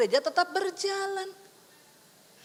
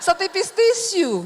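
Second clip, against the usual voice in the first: louder, slow, laughing; laughing punchline; 3 semitones higher, 7 dB louder than usual. Real, not acted. Preacher finishing the joke, laughing.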